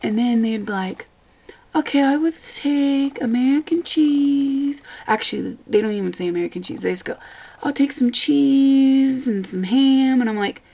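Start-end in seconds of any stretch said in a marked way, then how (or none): 1.8-4.8 slow, higher, louder customer impression; lightly mocking a vague orderer. Server imitating a customer ordering cheese, slow and higher. 7.6-10.2 slow, higher, louder put-on customer voice; mock dopey customer. Server mocking a customer's vague order, slow dopey voice.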